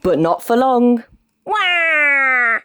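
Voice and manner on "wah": high mock baby wail. Baby starts crying again, mock wail.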